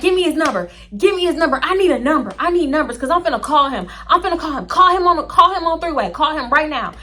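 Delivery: high, insistent retold demand; urgent demand. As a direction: push urgently, pitched well up. Acted demand during retold argument.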